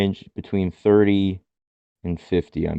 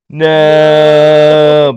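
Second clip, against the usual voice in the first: loud, held monotone no; mock monotone yell; Roddy; 6 semitones higher, 11 dB louder than usual. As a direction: drag it out on one raised note. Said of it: "it's all monotone 'cause it's Roddy".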